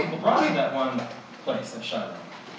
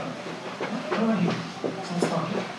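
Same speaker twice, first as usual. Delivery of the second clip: hushed question; 4 semitones higher, 7 dB quieter than usual. Acted. Spies overhear a voice.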